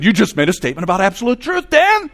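fast, loud, rising to a high yell on the name; shouted retort. Retold or imitated. Speaker catching the student in his contradiction, loud retort. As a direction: fire it back, climbing to a shout.

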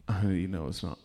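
low, soft dopey voice; imitating a clueless friend; dumb friend. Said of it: "He's so dumb".

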